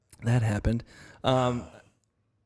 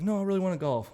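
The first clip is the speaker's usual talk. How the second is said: a hint of a child's higher-pitched plea; lightly voiced pleading kid; 8 semitones higher than usual. Young son pleading with dad to keep golfing, lightly imitated higher plea.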